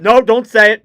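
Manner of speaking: sudden loud objection; alarmed protest. Real, not acted. Commentator stopping talk of overtime, alarmed protest. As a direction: cut yourself off loudly before jinxing it.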